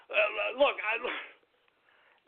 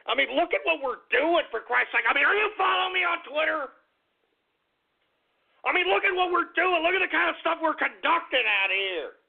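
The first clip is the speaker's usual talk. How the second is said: high-pitched, indignant appeal; worked-up radio host; exasperated; 8 semitones higher than usual. Real, not acted. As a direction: protest in raised pitch, demanding they see your side. Host indignantly defends his show.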